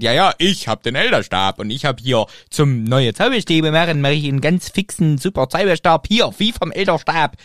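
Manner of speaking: loud, pushy TV-shop pitch; chipper 1950s ad. Retold or imitated. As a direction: sell it like a cheesy commercial. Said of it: "es wäre eine P-R-Aktion gewesen". (aufdringliche Werbestimme)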